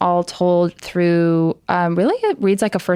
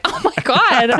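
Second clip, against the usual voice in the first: higher, gushing starstruck voice; mock fangirl gasp; 7 semitones higher than usual. Reading the host's note, higher and starstruck. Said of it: "but I was like".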